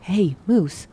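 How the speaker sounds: drawn-out, contained call; restrained narrated shout. A narrator's reading. Girl shouts to get Moose's attention, restrained narrated shout. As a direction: stretch the name in a mild shout. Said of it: "and I shouted again".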